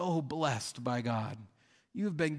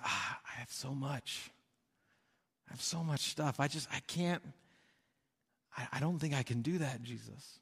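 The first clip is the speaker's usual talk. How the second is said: soft, hesitant regret; reluctant sigh; 6 dB quieter than usual. Acted. Rich young ruler turning Jesus down, softly regretful. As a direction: let him back away sadly, quietly.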